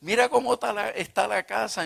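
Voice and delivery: imitated furious mother yelling; shrieking mother. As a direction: screech it like a mother who thinks you've lost your mind. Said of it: "mi mamá me abrió aquella boca y me dijo".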